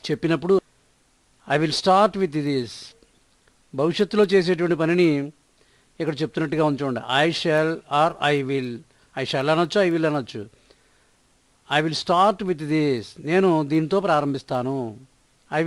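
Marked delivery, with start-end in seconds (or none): 1.5-2.7 slow, word by word, clearly spaced; slow model sentence. Shown as a demonstration. Teacher to Telugu learners, modelling the English sentence slowly. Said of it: "teacher models the sentence slowly". 11.8-13.0 slow, word by word, clearly spaced; slow model sentence. Shown as a demonstration. Teacher to Telugu learners, modelling the English sentence slowly. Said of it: "teacher models the sentence slowly".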